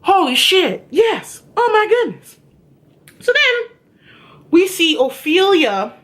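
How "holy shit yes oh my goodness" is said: a higher, lightly voiced cop gasping in surprise; imitating the shocked cop. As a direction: mimic the cop's shocked outburst, pitched up. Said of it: "to quote the cop".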